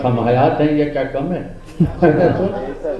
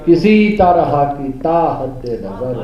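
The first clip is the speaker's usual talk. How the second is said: mushaira recitation; 4 semitones higher, slower than usual. Acted. Poet repeating his line amid the praise, declamatory. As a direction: let each repeat of the refrain sink.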